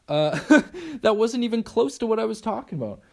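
high-pitched, laughing through the words; amused laughing. Real, not acted. Host laughing at how far off topic they went, amused. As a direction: laugh at your own rambling. Laughs at own rambling tangent.

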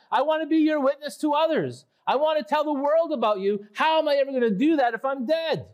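desperate psalmist. Acted. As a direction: beg God to let him live. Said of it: "He's telling God".

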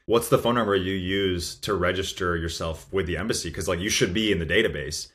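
usual speaking voice

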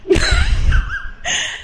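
high wheezy laugh; breathless wheeze of laughter; wheezing laugh. Real, not acted. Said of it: "I keep wheezing".

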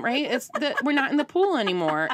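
normal talking voice

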